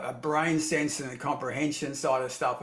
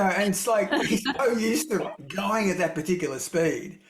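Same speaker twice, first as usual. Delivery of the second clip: ordinary talk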